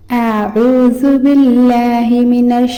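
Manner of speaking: slow, drawn-out tajweed recitation; slow melodic Arabic recitation; Quranic recitation. Acted. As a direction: chant it slowly and reverently. Recites ta'awwuz and bismillah.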